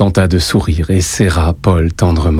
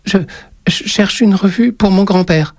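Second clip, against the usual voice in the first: put-on high, halting child's voice; timid, very high-pitched reply; frightened boy; 12 semitones higher than usual. A narrator's reading. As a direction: stammer it as a scared little boy. Petrified boy stammers to witch.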